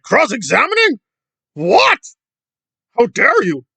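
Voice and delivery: high, loud scandalized impression; mock outrage. Commentator imitating Scoville hearing of cross-examining, hammed-up outrage. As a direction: act appalled, pitch shooting up. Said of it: "Scoville's like".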